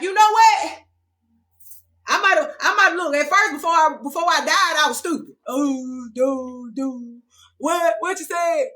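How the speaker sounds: high, loud, exaggerated waking-up voice; awakened person. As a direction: overact someone seeing the truth. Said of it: "and be like".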